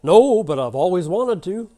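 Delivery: loud, high, drawn-out eager reply; hammed-up Texan punchline; quick-thinking Texan. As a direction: blurt it big and slow as the cornered cowboy. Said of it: "the quick-thinking Texan says".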